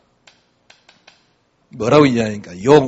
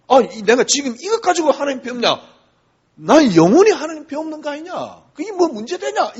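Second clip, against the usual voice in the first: Job's retort; 11 semitones higher than usual. Retold or imitated. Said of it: "욥이 하는 말입니다".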